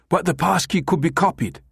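high-pitched, agitated objection; agitated protest. A narrator's reading. Numataka objecting to the American's deal, agitated. Outraged buyer protesting by phone.

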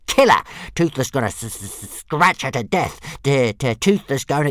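loud stuttering furious dragon; indignant dragon. Acted. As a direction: rant it, spitting mad.

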